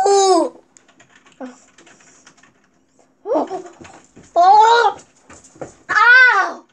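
loud pained yelps from sourness; sour pain cries. Real, not acted. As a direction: cry out at the burning sour. Reacting to intense sour taste.

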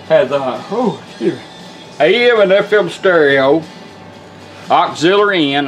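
usual speaking voice

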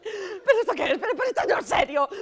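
high, loud, flustered protest; panting, panicky outburst with rising pitch; hyperventilating panic. Acted. Nervous accomplice balking at the absurd disguise, hyperventilating. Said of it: "que estás hiperventilando".

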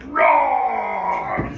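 loud, long roar of an angry bear; mock bear roar, drawn out. Bear acted smashing chair.

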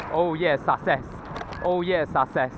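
usual speaking voice

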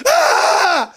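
high-pitched acted wail; mock scream. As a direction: cry out high, as if just robbed. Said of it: "הוא נתן צעקה". (צעקת שבר מוצגת)

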